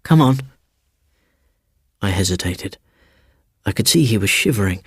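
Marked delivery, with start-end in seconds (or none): 0.0-0.4 louder, urgent; urgent call. A narrator's reading. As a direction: hurry him along. Seaton urging.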